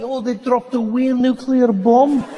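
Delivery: put-on high, breezy Scottish accent; harmless Scottish lilt. Comic downplaying a disaster as a Scot, high harmless lilt. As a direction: make disaster sound tiny and innocent in Scottish. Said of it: "nothing sounds dangerous like that".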